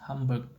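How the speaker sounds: "Hamburg" merged with m before b; rapid-speech pronunciation. Shown as a demonstration. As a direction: drop the d, saying "Hamburg" with an m. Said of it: "In rapid speech".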